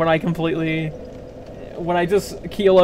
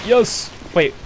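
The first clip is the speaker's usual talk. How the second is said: ordinary delivery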